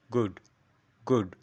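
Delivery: গুড, গুড, each quick and short; short GOOD. Shown as a demonstration. Teacher drilling learners, short গুড. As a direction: drill a brief গুড with pauses between. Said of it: "short করে দেবো".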